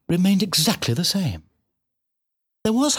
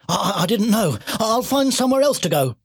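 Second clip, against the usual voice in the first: high, stammering piglet voice; flustered apology; 8 semitones higher than usual. A narrator's reading. Piglet apologising for sleeping in the cat's tree, flustered. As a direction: apologise hastily in a higher pitch.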